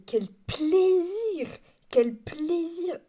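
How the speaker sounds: slow, high, drawn-out rapture; gushing delight. Acted. Podcaster raving about hand dryers in London, gushing delight. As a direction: savour it with hammed-up bliss.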